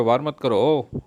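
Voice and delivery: plain reading voice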